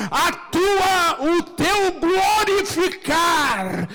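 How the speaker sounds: high, loud shouted preaching, words held long; impassioned, high-pitched preacher's cry; full-force preaching. Real, not acted. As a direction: shout it to the congregation, stretching the final words.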